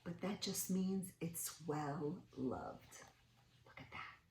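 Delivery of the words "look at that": hushed conspiratorial whisper. Acted. Whispering to the children about the book, hushed. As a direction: lean in and whisper the secret.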